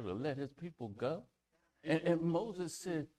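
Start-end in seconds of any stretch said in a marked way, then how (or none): none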